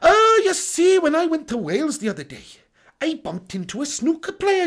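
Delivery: high, sing-song Welsh lilt; exaggerated, swooping Welsh storyteller; Welsh accent. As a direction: spin a yarn in a hammed-up Welsh accent. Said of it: "do a little Welsh accent for you".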